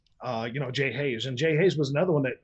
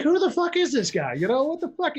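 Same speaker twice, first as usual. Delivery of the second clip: shocked outburst; 12 semitones higher than usual. Retold or imitated. Retelling his shocked reaction.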